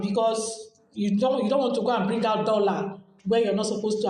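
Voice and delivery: normal talking voice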